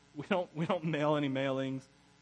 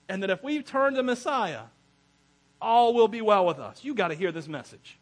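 urgent, raised voice spreading big news; excited report; 4 semitones higher, 7 dB louder than usual. Acted. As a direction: tell it loud and high like astonishing news. Gentiles excitedly telling others the news.

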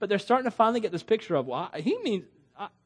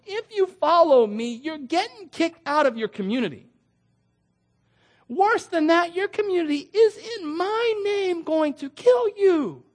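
impassioned Jesus; 11 semitones higher, 4 dB louder than usual. Acted. Jesus warns disciples of persecution.

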